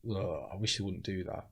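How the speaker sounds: quiet disgusted aside; muttered groan. Retold or imitated. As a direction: say it under your breath, wincing. Comics' private reaction, voiced.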